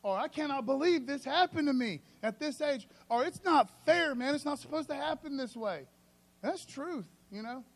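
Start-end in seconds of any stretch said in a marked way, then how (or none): 0.2-5.9 mildly higher, louder frustrated protest; a touch of a griping believer; lightly voiced complaint. A believer complaining that life is unfair, mild exasperation. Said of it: "Sometimes we look at our life like".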